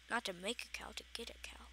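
a quiet muttered fix; whispered aside. Real, not acted. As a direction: whisper the correction to yourself. Whispered self-correction.

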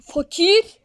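high, loud call across. Pretend. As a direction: shout his name from afar. Calling out for the poor man.

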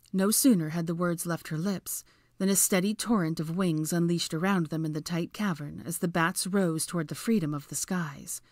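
ordinary delivery

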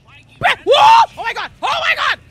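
high, loud startled yell; loud panicked oh my God; shocked shout. Real, not acted. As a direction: scream it in real shock. Sudden chase in game.

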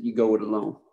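lightly impersonating a Texan; Texas drawl. Retold or imitated. Johnson telling Israel to go alone in a Texas accent. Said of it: "with his Johnson City, Texas accent".

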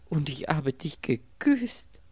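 high, loud, slow dismay; distressed. A narrator's reading. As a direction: wail it in drawn-out dismay. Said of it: "rief sie in einem Ton tiefster Not". (gequält und entsetzt)

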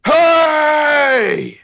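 long, high, held holler; distant shout. Acted. Game master as a stranger hailing the party, long shout. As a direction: holler it far across the distance. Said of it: "shout it like how he did".

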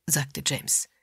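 ordinary delivery

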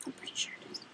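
soft whispered aside; whispering. Real, not acted. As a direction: whisper it to yourself. Repeats herself, then whispers it.

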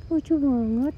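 excited gush. Real, not acted.

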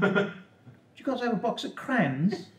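put-on high, childlike demon voice; a sweet demon asking for crayons; goofy harmless demon. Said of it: "One that was like".

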